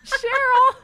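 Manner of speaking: high laughing squeal. Real, not acted. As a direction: laugh through the words. Laughing at crying cohost.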